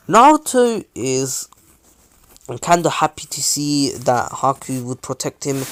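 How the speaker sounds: ordinary delivery, nothing marked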